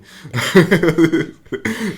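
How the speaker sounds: high loud burst of laughter. Real, not acted. Laughs at own story.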